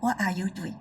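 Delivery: low and quiet, no emphasis on what are you doing; a quiet, unbothered ask; soft flat question. Shown as a demonstration. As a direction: mutter it dully. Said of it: "the same words I say".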